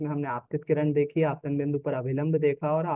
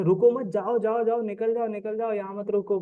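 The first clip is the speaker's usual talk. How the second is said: higher, pressing "go, go" warning; urgent warning; 5 semitones higher than usual. Acted. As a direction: hurry someone away from the traffic police. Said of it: "traffic police पकड़ रही है". (जल्दी भगाने वाली चेतावनी)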